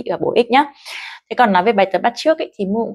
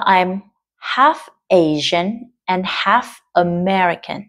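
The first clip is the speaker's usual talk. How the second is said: slow, clear "half" each time; slow model reading; slower than usual. Shown as a demonstration. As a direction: read it slowly like a model sentence. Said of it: "cái từ khó ở đây đó là từ half". (đọc mẫu chậm rãi)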